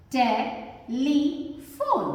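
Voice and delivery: "टेलीफोन" very slow, as टे, ली, फोन; syllable by syllable. Shown as a demonstration. Teacher introduces word to learners, "टेलीफोन" slowly split. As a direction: sound out "टेलीफोन" in three separate parts.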